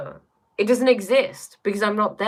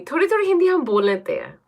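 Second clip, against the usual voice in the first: rapid, high Hindi with a put-on Indian accent; 10 semitones higher, 4 dB louder, much faster than usual. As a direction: rattle off the Hindi in a showy accent, fast. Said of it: "in that accent".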